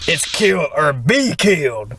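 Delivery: "be" leaned on in "kill or be killed"; slow, menacing, pressing on "be"; stress on be. Acted. Gamer reading the flower's threat, stressing "be" slowly. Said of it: "The emphasis on the B".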